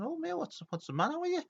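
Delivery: high, whiny, pleading voice; mock upset player; upset mate. Player getting upset with nerd.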